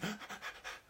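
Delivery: quick, shallow breaths; short audible puffs of fast breathing; panting. Shown as a demonstration. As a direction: pant rapidly as if stressed. Demonstrates fast breathing in class.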